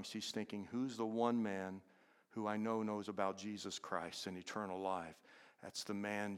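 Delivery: low, very soft sermon voice; solemn preaching. Real, not acted. Preacher reflecting on a dying woman's wish, hushed and solemn. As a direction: let the voice sink into a grave hush.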